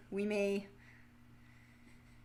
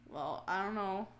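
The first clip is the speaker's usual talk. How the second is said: ordinary delivery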